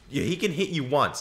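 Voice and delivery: usual speaking voice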